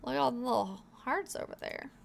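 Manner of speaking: ordinary talk, nothing marked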